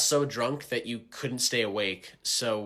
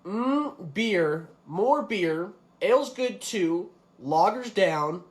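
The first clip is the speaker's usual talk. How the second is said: mock beer-lover's musing, higher pitched; beer-loving halfling; 6 semitones higher than usual. Game master reads halfling's bio back to player, mockingly beer-obsessed. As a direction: savour each item slowly, mocking the bio. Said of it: "all you really wrote in your bio".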